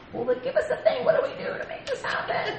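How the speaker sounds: very high, laughing plea; mock pleading. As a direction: squeal it like an impatient listener, playfully. Voices eager listeners wanting instructions.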